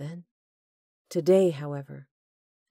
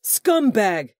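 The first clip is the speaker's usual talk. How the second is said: loud, higher insult shouted; yell; 6 semitones higher, 10 dB louder than usual. Acted. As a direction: spit the insult at him. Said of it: "Corbin yelled".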